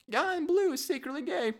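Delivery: very high squeaky bird voice; gossiping parrot. Pretend. Parrot whispers gossip about a visitor, very high squeak.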